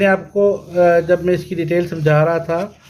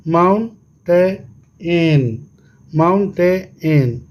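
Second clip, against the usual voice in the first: slow "mountain" as mount, टे, एन; three separate beats in mountain, ending on a detached एन; syllable by syllable; much slower than usual. Shown as a demonstration. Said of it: "कितना syllable हो गया जी". ("Mountain" तीन हिस्सों में तोड़कर)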